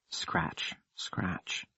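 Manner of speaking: soft unvoiced scratch scratch; eerie hushed whisper. A narrator's reading. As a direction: breathe it like something scraping.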